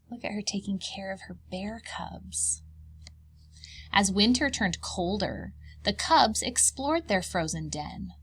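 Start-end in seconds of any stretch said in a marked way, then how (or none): 0.1-2.6 soft whispered aside. Real, not acted. Reader pointing at the picture, whispering. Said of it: "unvoiced whisper".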